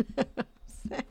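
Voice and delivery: breathy laughing repeat; laughing whisper. Real, not acted. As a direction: giggle the line out softly.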